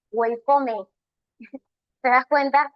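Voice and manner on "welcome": "Welcome" said as "wel-ko-me" with an extra final e, Spanish style; beginner's pronunciation. Shown as a demonstration. Teacher showing students the beginner's "Welcome" as "welkome". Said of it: "¿cómo lo pronunciabas?".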